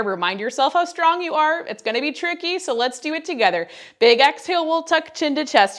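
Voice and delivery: high, loud pep push; energetic coaching. Real, not acted. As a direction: pump them up. Coach pushing through hard move.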